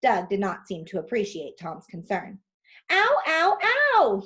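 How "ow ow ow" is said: high, loud repeated cries of pain; pained bellowing. Acted. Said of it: "he kept bellowing".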